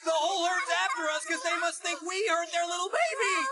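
high, loud wailing shout; panicked wail. Acted. Brock is chased by the whole herd, panicked wail. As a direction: cry it out while running for your life.

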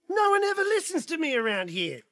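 hammed-up motherly grievance; exasperated mother. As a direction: lament that you're never heeded. Mama Bear complains, unheard.

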